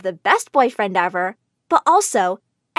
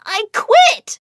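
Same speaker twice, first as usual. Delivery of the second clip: high, loud, slow yell; humiliated yell; 10 semitones higher, 5 dB louder, much slower than usual. Acted. Humiliated girl yelling at Mom and the crowd, high loud outburst. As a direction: scream it out before running off. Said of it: "I began to yell".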